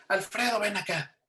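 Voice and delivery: retold mother calling her son to come over; mom calling out. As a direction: call him in like an annoyed mom.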